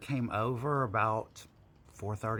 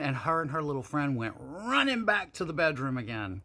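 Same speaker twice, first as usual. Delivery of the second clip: ordinary delivery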